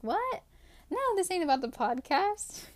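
high-pitched, startled retort in a retold exchange; incredulous. Wife answering husband's podcast guess, incredulous. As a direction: act out a taken-aback "what?" and quick denial.

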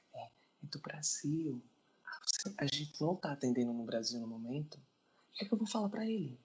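muttered off the phone to a colleague; hushed aside. Acted. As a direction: cover the mouthpiece and confer quietly. Aside to superiors, off line.

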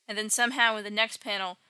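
usual speaking voice

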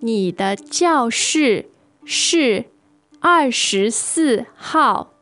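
usual speaking voice

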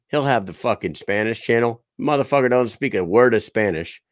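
ordinary talk, nothing marked

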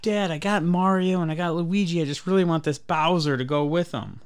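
put-on high, earnest kid's plea; imitating a pleading kid. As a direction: coax dad like a hopeful kid. Retold kid begging for a toy.

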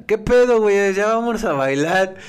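loud, high slurred yell, slowing at the end; drunk friend shouting. Retold or imitated. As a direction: play the wasted friend bossing everyone. Drunk friend yells at manager. (grito de borracha)

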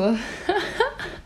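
hohes, kicherndes Lachen. Real, not acted. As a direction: vor Vorfreude loskichern. Erzählt lachend die Geschichte. (hohes Kichern vor Vorfreude)

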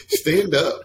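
mock stern command; impatient wife. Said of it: "she's like".

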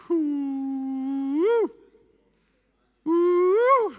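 high, rising joyful whoop; fired-up whoop. Real, not acted. Preacher whooping at his congregation, excited high cry. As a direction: whoop like the Spirit just hit. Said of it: "I'm getting fired up now".

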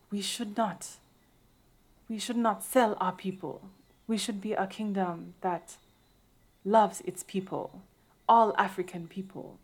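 earnest dramatic actress voice, lightly done; grave movie-queen delivery; imitating Viola Davis. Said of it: "That is me imitating Viola Davis".

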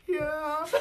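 high, mock-tearful kid voice; crying little boy. Scared boy answering his dad from the stall, mock crying. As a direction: squeak it out through pretend sobs. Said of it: "this boy had started crying".

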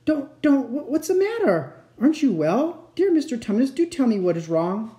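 high, stammering concern; great distress. A narrator's reading. Lucy begging the crying fawn to tell her, distressed. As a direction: plead anxiously, pitched well up. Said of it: "said Lucy in great distress".

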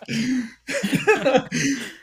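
big burst of laughter; loud high laugh. Real, not acted.

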